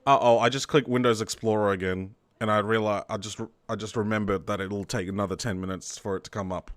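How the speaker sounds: ordinary delivery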